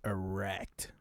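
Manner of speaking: low, hushed character imitation; soft, deep mock anime-hero voice; Jin Woo. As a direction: drop down low and almost whisper. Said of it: "he's kinda down here with it".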